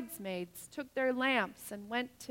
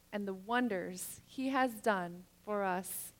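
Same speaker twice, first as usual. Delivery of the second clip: ordinary delivery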